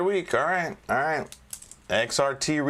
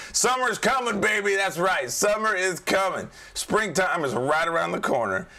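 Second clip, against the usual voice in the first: excited; 7 semitones higher than usual. Real, not acted. Hyping summer beer season.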